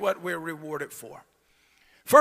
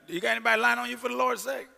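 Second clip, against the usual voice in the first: ordinary delivery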